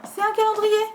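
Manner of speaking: high-pitched thrilled exclamation; excited discovery. Real, not acted. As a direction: exclaim it with delighted surprise. Discovers it is a calendar. (exclamation aiguë et ravie)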